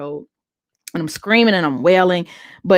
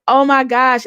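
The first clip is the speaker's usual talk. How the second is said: surprised; 6 semitones higher, 5 dB louder than usual. Retold or imitated. Sudden realization while praying.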